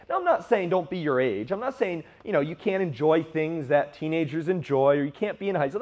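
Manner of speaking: ordinary talk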